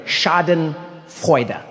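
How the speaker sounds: drawn-out, deliberate "Schadenfreude"; slow demonstration. Shown as a demonstration. Comic teaching the audience "Schadenfreude", slow and deliberate. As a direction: stretch out "Schadenfreude" slowly in two parts. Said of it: "German word".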